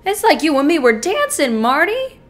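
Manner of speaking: loud, elated praise broken by laughter; exhilarated bragging with cackling laughs; gleeful gloating. Acted. Killer gloats over the courtroom trick.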